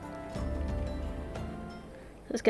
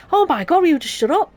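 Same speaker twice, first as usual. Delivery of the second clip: loud, fed-up snap; exasperated outburst; 6 semitones higher, 6 dB louder than usual. Real, not acted. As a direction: yell at the interruption. Exasperated at an interrupting voice.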